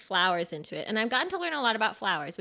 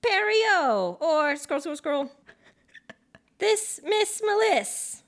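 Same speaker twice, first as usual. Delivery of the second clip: playful announcing; 6 semitones higher, 5 dB louder, slower than usual. Real, not acted. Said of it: "scrolling through listener names aloud".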